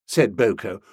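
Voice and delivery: plain reading voice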